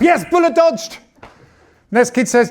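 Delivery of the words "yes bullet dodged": relieved. Acted. Relieved dad reacts.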